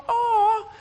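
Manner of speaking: high drawn-out aww; mock aww of pity; cooing aw. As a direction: coo over the baby in the basket. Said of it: "she's like".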